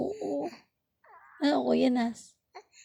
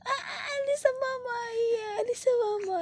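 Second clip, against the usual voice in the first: put-on high, quick whiny baby voice; mock-whimpering high baby voice; high baby talk; 10 semitones higher than usual. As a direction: fuss like a baby pulling away from mama. Mother playfully fussing at baby.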